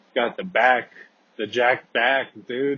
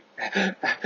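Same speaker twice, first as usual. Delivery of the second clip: imitated high, breathless gasps; winded gasping; 10 semitones higher than usual. Showing himself laying on the floor, winded gasps. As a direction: mimic struggling for air.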